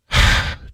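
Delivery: long weary exhale; big sigh of relief; deep sigh. Real, not acted. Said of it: "deep breath".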